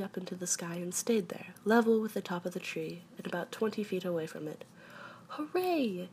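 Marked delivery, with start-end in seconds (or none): 5.3-6.1 mild storybook cheer, pitched high; shout. A narrator's reading. You cheer as Pooh floats up, mild high shout. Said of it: "you shouted".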